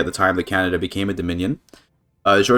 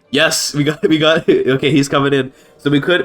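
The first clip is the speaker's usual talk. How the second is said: excited; 5 semitones higher, 4 dB louder than usual. Real, not acted. The wanted king joins at last.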